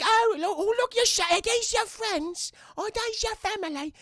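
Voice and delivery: put-on high-pitched wondering child voice; delighted little girl. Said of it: "she's like".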